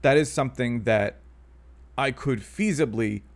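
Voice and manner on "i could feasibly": ordinary talk, nothing marked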